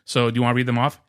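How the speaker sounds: normal talking voice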